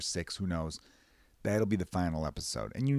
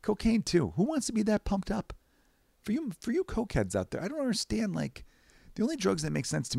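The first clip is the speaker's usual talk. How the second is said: pitched way up in disbelief; incredulous high voice; 13 semitones higher than usual. Real, not acted. Talking to cokeheads in a high incredulous voice. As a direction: squeak out your bafflement at cokeheads.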